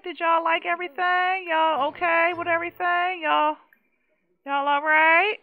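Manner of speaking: nervous host asking guests; anxious eager asking. Real, not acted. Anxious check on sims.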